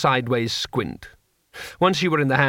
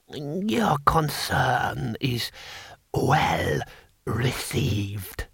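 put-on very slow, swooping old ruler; ancient emperor; 4 dB quieter, much slower than usual. Emperor answering the courtier, slow ancient voice.

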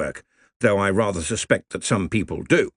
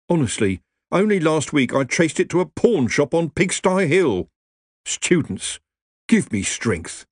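higher, aggrieved nervous wizard; whiny wizard; 4 semitones higher than usual. Acted. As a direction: complain like a put-upon clerk. Rincewind complains.

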